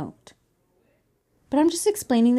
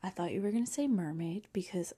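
whispering; 5 semitones lower, 10 dB quieter than usual. Real, not acted. Keeping quiet at bedtime.

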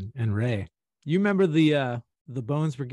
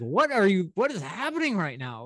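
baffled; 8 semitones higher, 3 dB louder than usual. Retold or imitated.

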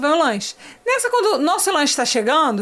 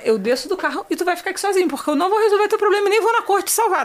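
higher, insistent telling-off; emphatic mock threat; retold warning; 6 semitones higher, faster than usual. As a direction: lay down the law, lightly acted. Acted warning to Marcos.

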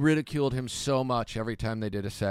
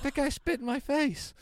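much higher, whiny outrage; indignant protest. Retold or imitated. Comic replaying his protest to the club, much higher and whiny. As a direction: plead your innocence.

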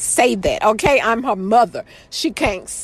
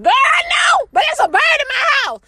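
high shouted defense; 13 semitones higher than usual. Real, not acted. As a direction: shout it like it's obvious. Defending herself to audience.

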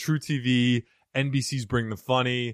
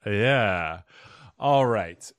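ordinary talk

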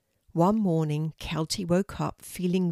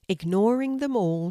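ordinary delivery

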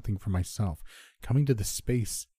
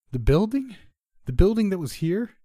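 high, loud puzzled question; confused; 12 semitones higher, 7 dB louder than usual. A narrator's reading. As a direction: ask it in raised, baffled disbelief. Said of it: "He seemed confused".

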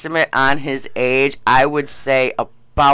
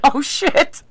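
much higher retold gasp of shock; retold shocked reaction; 11 semitones higher than usual. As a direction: replay your own startled reaction. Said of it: "And I'm like".

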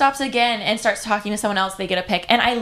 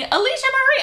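a much higher, joyful shout of Alicia Marie; screaming her name; 13 semitones higher than usual. Retold or imitated. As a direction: call out like greeting a best friend. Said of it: "just screaming".

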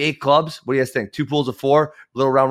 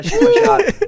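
high begging plea; 8 dB louder, slower than usual. Retold or imitated. Begging coach for a match.

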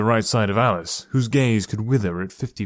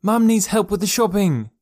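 much higher, louder call of a sister; shouting through a door; 10 semitones higher, 4 dB louder than usual. Acted. Sister shouts at her brother through the bedroom door. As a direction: yell to wake him through the door.

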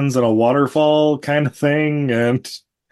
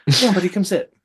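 coaxing the dog; 11 semitones higher than usual. Real, not acted.

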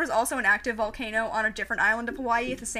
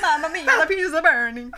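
loud laughing cartoon Italian, a bit higher; mock Italian accent; 4 semitones higher, 8 dB louder than usual. Joking about Pompeii burning, laughing mock Italian accent. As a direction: cry it like a panicked pizza chef through laughter.

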